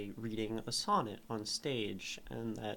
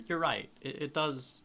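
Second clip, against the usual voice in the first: ordinary talk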